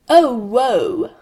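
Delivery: loud, high theatrical lament; hammed-up tragic wail; Shakespearean proclamation. A narrator's reading. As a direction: proclaim it like a stage tragedian. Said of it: "in quite a Shakespearean way".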